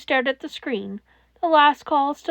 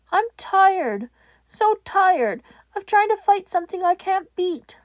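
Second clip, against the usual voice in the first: higher, worn-out plea; despairing; 7 semitones higher, 5 dB louder than usual. Acted. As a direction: sound utterly spent. Exhausted despairing boy.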